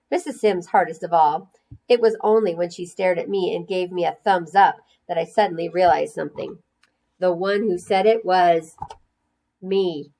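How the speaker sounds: usual speaking voice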